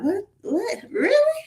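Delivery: an incredulous, climbing what, really; disbelief. Retold or imitated. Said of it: "And I'm like".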